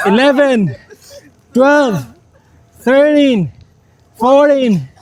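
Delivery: strained counting mid push-up; doing push ups. Real, not acted. Counting reps mid push-up set.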